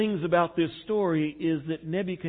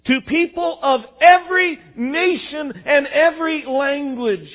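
high, loud herald's proclamation; royal edict; 11 semitones higher, 12 dB louder than usual. Retold or imitated. Pastor voicing Nebuchadnezzar's edict to all nations, high loud proclamation. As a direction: announce it like a king's crier to the empire. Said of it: "this is an official edict".

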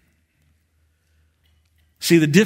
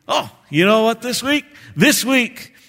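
put-on old barber; 8 semitones higher, 5 dB louder than usual. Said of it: "and he would say".

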